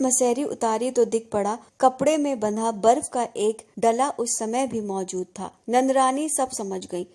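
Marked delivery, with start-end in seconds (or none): none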